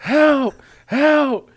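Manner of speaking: high, loud re-enacted yell; retold scream for help. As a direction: call out for help at the top of the register, drawn out. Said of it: "So I started screaming".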